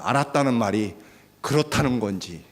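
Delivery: ordinary delivery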